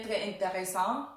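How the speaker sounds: ordinary talk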